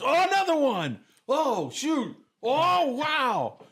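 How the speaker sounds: high, loud imitation of excited fishermen; mock yelling. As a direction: mimic whooping shouts over each catch. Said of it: "What are you guys yelling about?".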